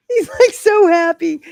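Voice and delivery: high, loud, giddy gushing; giddy delight. Real, not acted. Owner gushes to viewers about her happy dog, giddy. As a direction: gush loudly, right after a laugh.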